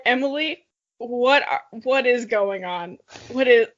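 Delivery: ordinary delivery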